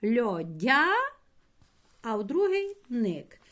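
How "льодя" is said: "Льодя" said loud and separate, as a hyphenated chunk; loud teacher's split of "Льодя"; syllable split demo. Shown as a demonstration. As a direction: call out "Льодя" as the first part of the split. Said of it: "Як ще можна перенести це слово".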